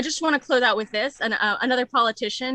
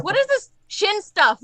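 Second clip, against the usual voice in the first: mock disbelief; 7 semitones higher than usual. Mock bafflement at own joke.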